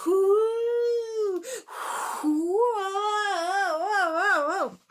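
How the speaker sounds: loud high whooshing whoo; wind howl. Shown as a demonstration. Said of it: "Can you be the wind".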